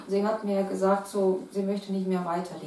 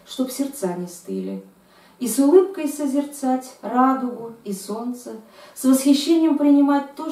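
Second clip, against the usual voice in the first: raised, louder, declaiming recital; poem recitation; 5 semitones higher, 7 dB louder than usual. Acted. Poet reads her Russian poem aloud for the interviewer, declaiming. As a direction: read it like a stage poem.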